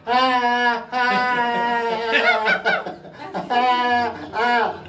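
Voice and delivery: hammed-up high wailing cries of a child; mock baby crying. Nimai pretends to cry from fright, hammed-up high wail. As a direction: fake a toddler's frightened bawling.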